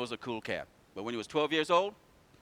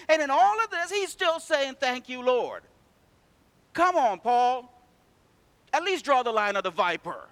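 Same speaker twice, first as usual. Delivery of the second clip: loud, rising sermon cry; preaching at full force; 7 semitones higher, 5 dB louder than usual. Acted. Preacher rousing the congregation, loud and fervent. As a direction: preach it out to the whole church.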